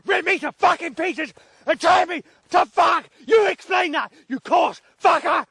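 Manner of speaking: cursing at full lungs, voice cracking high; real furious shouting. Real, not acted. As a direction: rage screamed at the creator god. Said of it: "What I was shouting like just now".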